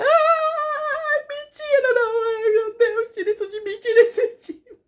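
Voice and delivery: high, loud cries of panic breaking into laughter; desperate laugh-crying. Retold or imitated. Reliving the moment she learned she was pregnant, desperate laugh-crying. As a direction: laugh and sob at once out of sheer panic. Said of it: "É rir de desespero".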